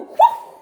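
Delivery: loud whooshing noise; loud whoosh sound effect. Acted. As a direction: make the sound of a throw. Crocodile flung into the sky.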